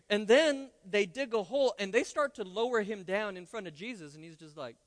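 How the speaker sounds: ordinary talk, nothing marked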